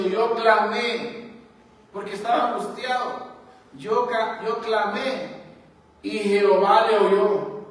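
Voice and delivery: loud, slow cry of David, rising to full volume; fervent preaching. Acted. Preacher voicing David to the church, loud and slow. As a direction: proclaim it like a man who was heard.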